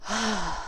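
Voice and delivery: big, long exhale of relief; breathy falling sigh, demonstrating relief. Shown as a demonstration. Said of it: "this really big sigh of relief".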